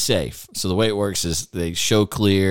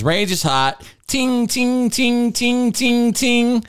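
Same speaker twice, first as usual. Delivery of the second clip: much higher pinging "ting" sounds like rounds ringing steel; steel ping imitation; 3 dB louder, much slower than usual. Host imitating the shooter's hits on steel, high pinging. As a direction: mimic rapid hits ringing on steel plates. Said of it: "He goes".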